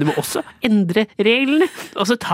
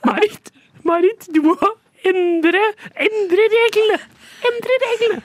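climbing higher and higher into a wailing cry; escalating mock cry; 11 semitones higher, slower than usual. Comic host cries out his rule, higher each time. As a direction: build the catchphrase to a frantic high peak.

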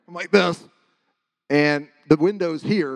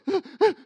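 high, quick frozen gasps; retold gasps of terror; terrified gasps; 4 dB louder than usual. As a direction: act out panicked gasping. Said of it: "I was just like".

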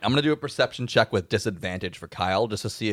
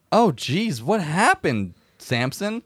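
higher, lightly voiced nice guy; Kyle, concerned dope; 6 semitones higher, slower than usual. Game master as Kyle to gunk-covered friend, mildly concerned. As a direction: ask it in mild, dopey concern. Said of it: "looks at you and goes".

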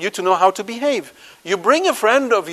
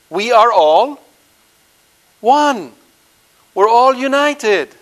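louder, higher, slow and grand like a public slogan; mock proclamation; 5 semitones higher, 4 dB louder, slower than usual. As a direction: proclaim it like a hollow chant. Mocking a false unity proclamation.

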